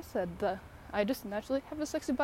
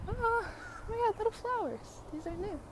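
much higher, sweet cooing at the flowers; 12 semitones higher, 3 dB quieter than usual. Real, not acted. Spotting new flowers on a walk, high delighted coo.

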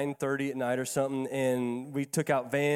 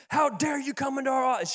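high, sharp imitation of an angry woman; indignant mock outburst; mock yell; 8 semitones higher than usual. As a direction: play the furious office lady scolding. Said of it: "She comes out yelling at me".

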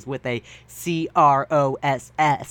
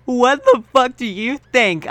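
higher, louder mock-angry snap; 7 semitones higher, 5 dB louder than usual. Ebony snapping at her bandmate, hammed-up anger. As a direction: hurl it back angrily. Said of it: "I asked angrily".

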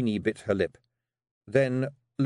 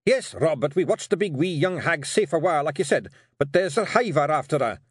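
high, louder Scottish clansman; blurting out; 6 semitones higher, 4 dB louder than usual. Acted. Hamish reports a hiver to Rob, blurting out. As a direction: blurt the bad news out, pitched up. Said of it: "Hamish blurted out".

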